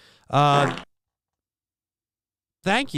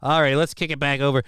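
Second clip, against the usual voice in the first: ordinary delivery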